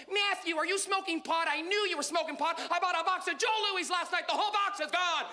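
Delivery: high-pitched parody of a suspicious mom; Canadian mom. Acted. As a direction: play a nagging Canadian mother. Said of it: "You come home and your mom".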